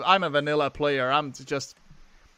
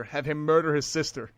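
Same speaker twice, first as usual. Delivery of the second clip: ordinary talk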